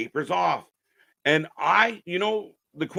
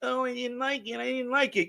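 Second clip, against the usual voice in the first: mocking whine; 5 semitones higher, 7 dB quieter than usual. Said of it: "they turn around".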